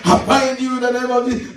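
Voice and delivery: hammed-up prayer-warrior binding; noisy prayer warrior. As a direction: imitate a showy prayer warrior, chanting on a high pitch. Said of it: "they make a lot of noise".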